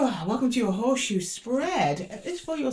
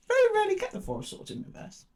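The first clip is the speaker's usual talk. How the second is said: surprised; faster than usual. Real, not acted. Surprised by rare card.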